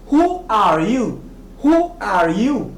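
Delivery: "Who are you" slowly, each word drawn out; model pronunciation. Shown as a demonstration. Teacher drilling learners to repeat after her or him, model pronunciation. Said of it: "Prononcez bien après moi".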